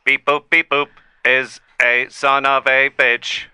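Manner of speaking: slow, word-by-word machine voice; robotic AI character. Pretend. AI telling the host about Beep Boop, robotic. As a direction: denounce a rival in measured robot speech. AI denouncing its rival.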